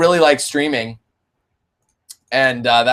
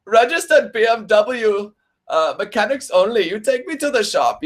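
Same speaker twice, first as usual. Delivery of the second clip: higher-pitched German car voice; German accent; 6 semitones higher than usual. Acted. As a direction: scold the driver in a mock German accent. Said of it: "I'll do my car's accent".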